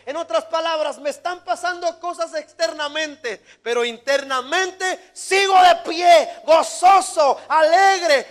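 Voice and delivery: high, very loud, drawn-out proclamation; full-force preaching. Real, not acted. Preacher declaring he still stands firm inside, full-force preaching. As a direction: build to a shouted declaration, stretching the key words.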